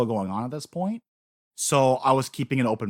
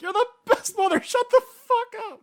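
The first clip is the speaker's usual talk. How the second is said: mock emotional outburst; 4 dB louder than usual. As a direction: squeal it like a fan overwhelmed by the scene. Retold own reaction to scene.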